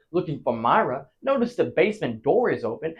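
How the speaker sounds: ordinary talk, nothing marked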